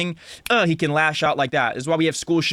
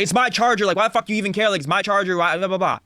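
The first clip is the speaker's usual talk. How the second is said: higher-pitched, defensive mock arguing; upset retort; 5 semitones higher than usual. Son arguing back at dad over his charger, upset. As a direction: imitate yourself snapping back at a parent. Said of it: "I would get upset with him".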